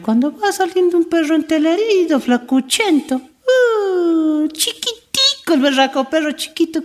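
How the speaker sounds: high, slow mock-tender voice for a tiny dog; hammed-up high baby-ish voice; mocking squeaky description. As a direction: squeak out how pitiful the pup is.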